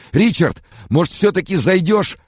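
very high, loud pleading; babbled plea. A narrator's reading. Sick man asks friend to drop by, babbling piteously. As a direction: whimper a helpless request, pitched right up. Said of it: "пролепетал Фрэнсис".

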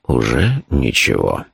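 low, slow, unruffled reply; deep, phlegmatic drawl. A narrator's reading. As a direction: say it with total indifference, dragging it out. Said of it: "флегматично сказал Шурф".

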